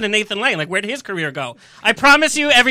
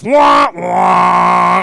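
sad trombone; 4 semitones lower, 7 dB louder than usual. Retold or imitated. Mock sad trombone sound.

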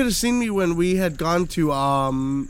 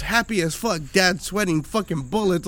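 ordinary talk, nothing marked

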